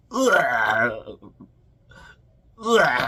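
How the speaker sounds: high, loud fake vomiting noise; loud mock retching. Buzz grossing out Kevin with loud fake retching. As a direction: ham up a big gross barf. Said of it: "So Buzz starts all like".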